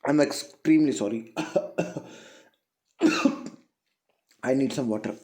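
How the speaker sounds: low, weakened voice between coughs; real coughing interruption. Real, not acted. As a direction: apologise softly through a cough. Coughing fit interrupts the lesson. (खांसी के बीच धीमी, दबी आवाज़)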